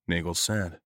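ordinary delivery, nothing marked

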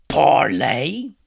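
loud, indignant pirate captain; yelled. A narrator's reading. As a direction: yell it as a gruff pirate. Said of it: "he yelled".

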